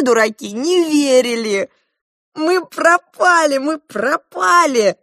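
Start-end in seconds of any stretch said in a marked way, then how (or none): 2.3-5.0 high, slow, loud wail; cry of despair. A narrator's reading. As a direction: wail that all is lost. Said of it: "закричали, пряча лица в ладони". (крик отчаяния, высоко и протяжно)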